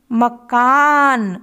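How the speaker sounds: ताला drawn out very slowly; slow reading demo for children; slow blending. Shown as a demonstration. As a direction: read ताला slowly syllable by syllable. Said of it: "इनको एक साथ मिलाकर पढ़ेंगे".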